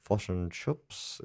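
slow caricature Kiwi "Fish and chips"; New Zealand accent. Shown as a demonstration. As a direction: squash the vowels into "fush and chups", Kiwi style. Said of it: "is the classic".

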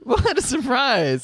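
high, loud, drawn-out fake astonishment; mock surprise. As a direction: play up the fake shock, slowly. Mocking own predictable Survivor habit.

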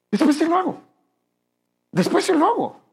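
put-on child to father, putting off the favor; lazy child brushing off. A whiny teen promising later. Child dodging parent's request.

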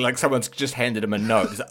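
normal talking voice

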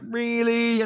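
high-pitched retold incredulous question; mock-indignant squeak of disbelief; annoyed disbelief. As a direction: say it like you can't believe the unfairness. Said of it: "really annoys me".

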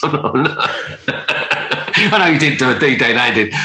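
chuckling through the words; laughing. Real, not acted. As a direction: laugh while protesting. Joking about not comparing to D-Day.

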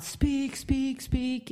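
lightly imitating a crowd demanding speech; mild mock yell. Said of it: "yelling out".

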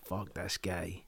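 very soft, slow, low murmur; quiet slow voiced inner thought of the villain; voicing the villain's thought. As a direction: breathe it out slowly, barely audible. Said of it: "He's like".

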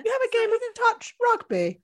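high, lofty posh voice; mocking her own posh delivery. Shown as a demonstration. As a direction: play the grand lady. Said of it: "Like that, is it?".

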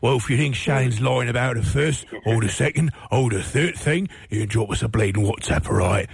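hammed-up Cockney geezer; mock London Cockney patter; Cockney accent. Shown as a demonstration. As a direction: put on a broad, cheeky Cockney. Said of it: "Was that your Cockney accent?".

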